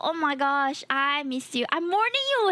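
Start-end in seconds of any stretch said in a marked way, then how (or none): 1.7-2.5 higher teasing threat; playfully warning Moondoggo; mock warning.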